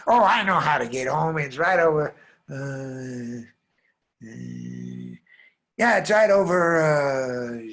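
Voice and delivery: loud boast trailing into unsure "uh"; bluffing confidence. Acted. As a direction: bluff loudly, then falter. Boy bluffing about knowing the way.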